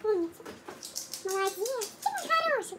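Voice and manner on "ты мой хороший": high, laughing praise for a dog; squeaky praising a dog. Real, not acted. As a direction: coo at the dog while giggling. Praising the dog after fetching.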